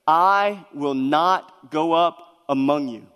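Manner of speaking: very slow, weighty declaration; solemn slow refusal. Retold or imitated. God refusing to go with his stiff-necked people, slow and solemn. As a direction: let each word fall heavily.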